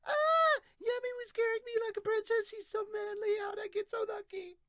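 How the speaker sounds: very high, loud squealing princess; quick high-pitched voice. Acted. Princess thinking about Yami carrying her, quick high-pitched squeal. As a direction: gush it in a hammed-up falsetto squeal. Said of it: "really quickly in a higher pitched voice".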